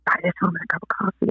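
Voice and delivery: soft, quick quoted whisper; hushed inner voice. Retold or imitated. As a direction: voice God's nudge quietly and quickly. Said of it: "I heard in my right ear".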